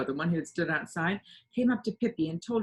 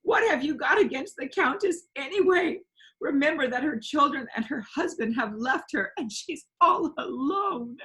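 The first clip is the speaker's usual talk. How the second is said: much higher, louder crying plea; sobbing plea; 8 semitones higher, 4 dB louder than usual. A narrator's reading. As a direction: defend her through tears. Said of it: "she sobbed".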